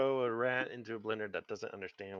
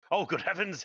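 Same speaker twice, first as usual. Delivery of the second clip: startled exclamation; 8 semitones higher, 5 dB louder than usual. Acted. GM voicing startled spider.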